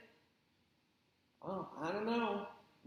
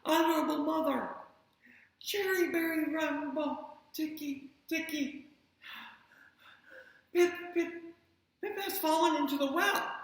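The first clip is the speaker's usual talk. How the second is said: flustered stammer; 8 semitones higher, 4 dB louder than usual. Acted. Chang stumbles on the name.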